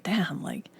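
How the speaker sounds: breathy whisper under the breath; whispered thought. Retold or imitated. Inner thought voiced as whisper.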